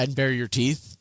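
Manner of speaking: ordinary delivery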